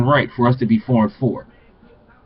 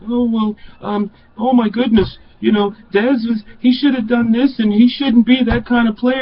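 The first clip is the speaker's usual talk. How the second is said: higher, whiny mock commentator voice; mocking the naysayers; 7 semitones higher, 3 dB quieter than usual. Mocking the video makers who criticize Dez, higher and whiny. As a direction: sneer it like a fussy pundit. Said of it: "I'm really tired of all that B S".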